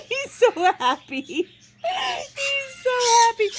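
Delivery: high, laughing coo at the happy dog; laughing delight. Real, not acted. Owner cooing at her wagging dog, laughing. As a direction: giggle through it, delighted.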